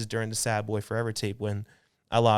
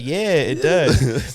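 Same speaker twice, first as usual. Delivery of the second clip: smug reply; 5 semitones higher, 11 dB louder, much slower than usual. Retold or imitated. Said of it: "And I'm like".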